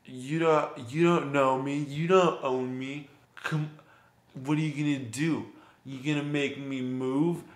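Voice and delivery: slow, mocking drunk taunt; belligerent drunk. Drunk man turns belligerent.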